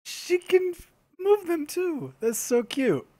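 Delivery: high-pitched, softened squeal of delight; cute gushing. Real, not acted. Gushing to friends over a cute avatar, high coo.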